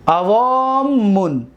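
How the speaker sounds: each sound drawn out; slow recitation. Shown as a demonstration. Teacher to students, slow drawn-out form. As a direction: lay the form out slowly.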